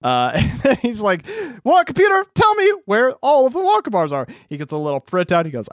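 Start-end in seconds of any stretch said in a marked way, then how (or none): 1.6-4.3 much higher, pompous order; mock command. Retelling the supercomputer bit, a high pompous mock command. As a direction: boss the machine like a proud inventor. Man commands his computer.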